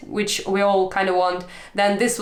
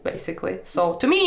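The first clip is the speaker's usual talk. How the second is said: usual speaking voice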